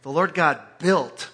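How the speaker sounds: ordinary talk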